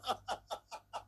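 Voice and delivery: short burst of laughter; laughing. Real, not acted. Laughs after the yawn.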